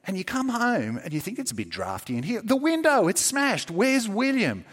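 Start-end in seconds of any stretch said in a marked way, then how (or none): 1.4-4.6 dawning alarm. Pretend. Discovering smashed window.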